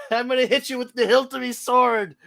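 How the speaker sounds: hammed-up Scottish brogue on the edge of laughter; Drew. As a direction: threaten in a silly high Scottish accent. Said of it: "if Drew was".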